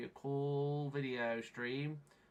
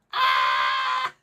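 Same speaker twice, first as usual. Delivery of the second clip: very loud raw yell; frustrated scream; 11 semitones lower, 15 dB louder than usual. Real, not acted. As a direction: let out a scream at the failure.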